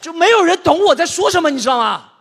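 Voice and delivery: loud, high despair; exasperated outburst. Real, not acted. Telling the audience nobody understands him, loud. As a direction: throw up your hands.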